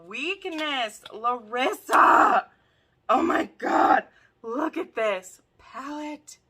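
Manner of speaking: loud, drawn-out gushing that climbs into a shriek; overjoyed squealing. Real, not acted. Thanking the gift giver for eyeshadows, ecstatic shrieking. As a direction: scream the friend's name in delight.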